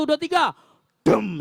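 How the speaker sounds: ordinary delivery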